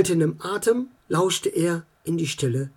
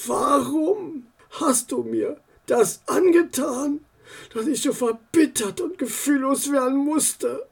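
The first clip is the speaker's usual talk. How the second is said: lowered fearful voice; 6 semitones higher than usual. Acted. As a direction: drop your voice, scared someone listens. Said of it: "Dann senkte er seine Stimme, so als habe er Angst".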